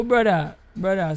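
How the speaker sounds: ordinary talk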